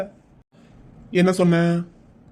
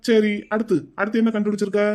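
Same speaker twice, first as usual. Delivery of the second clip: ordinary delivery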